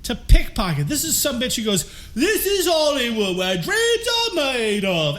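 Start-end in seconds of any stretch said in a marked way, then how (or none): 2.2-5.2 put-on deliberately terrible accent, pitched high; loud, high, hammed-up bad accent; Travolta's horrible accent. Mocking the actor's attempt, horrible put-on accent. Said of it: "that horrible accent is better than his accent".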